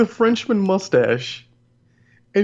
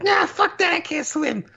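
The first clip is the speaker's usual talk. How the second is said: very high cartoon sidekick voice; Toad; 14 semitones higher than usual. Sidekick refusing the river jump, very high cartoon voice. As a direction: squeak out a refusal in a high mock voice. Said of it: "Toad's pretty much like".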